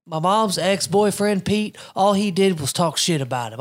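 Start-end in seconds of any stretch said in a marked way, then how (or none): none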